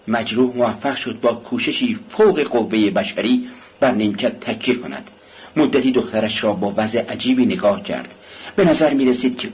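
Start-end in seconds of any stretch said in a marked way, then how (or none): none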